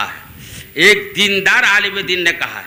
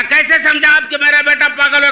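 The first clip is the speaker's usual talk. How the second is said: indignant demand; 7 semitones higher, 5 dB louder than usual. Acted. Father indignantly questions visitor.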